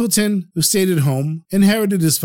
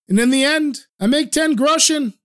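higher, proudly announcing his profit; proud simpleton; 8 semitones higher than usual. A narrator's reading. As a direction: beam as you name the sum.

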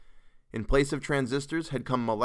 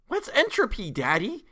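put-on very high little-girl voice; shrill kid character; 12 semitones higher, 3 dB louder than usual. A narrator's reading. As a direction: squeal the question like a curious child. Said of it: "shrilled Geraldette Two".

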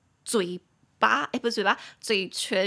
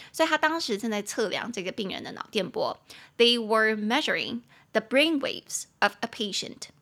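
ordinary talk, nothing marked

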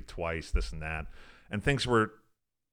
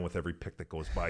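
usual speaking voice

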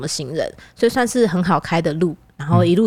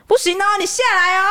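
higher-pitched imitation of a fierce uncle in Taiwanese; fierce old uncle; 11 semitones higher than usual. The uncle orders her out of the car, higher mock-fierce. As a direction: bark it like an angry old uncle. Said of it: "这样，很凶哦".

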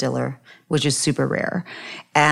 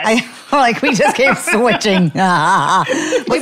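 laughing; 5 semitones higher, 4 dB louder, much slower than usual. Real, not acted. Laughing while tasting both drinks.

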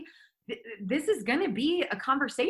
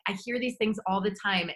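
usual speaking voice

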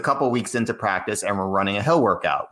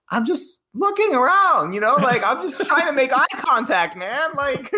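mock innocent pleading; 4 dB louder than usual. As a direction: squeak out that you mean no harm.

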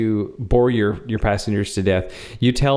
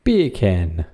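British accent attempt; 3 semitones lower than usual. Shown as a demonstration. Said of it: "We're gonna go standard British".

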